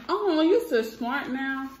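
high, slow praise. Real, not acted. Praising a child.

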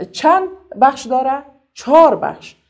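loud teacher drill; classroom drill voice. Shown as a demonstration. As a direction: call it out to the whole class, stretching each part. Teacher drills syllables aloud.

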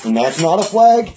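loud, high disbelieving shout; outraged protest. Real, not acted. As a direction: protest the missed call, pitch climbing. Outraged fan protesting a call.

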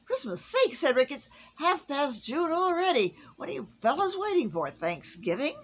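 high, loud, fast scolding; a flustered outburst; exasperated Santa. A narrator's reading. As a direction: chide the lazy elves.

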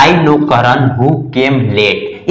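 very slow, word by word; slow model sentence. Shown as a demonstration. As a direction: read it out slowly for the class. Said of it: "ये देखेंगे कि sentence में".

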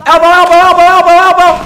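loud, high hype shouting; frat bro. Real, not acted. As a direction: yell it like you just clutched the round.

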